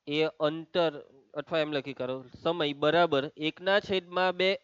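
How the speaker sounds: slow, measured dictation to the class. Acted. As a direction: dictate phrase by phrase, leaving long pauses.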